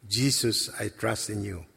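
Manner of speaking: hushed, fading repetition of the prayer; soft prayer. Retold or imitated. Man repeats after God's voice, hushed and fading. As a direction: let it sink to almost nothing.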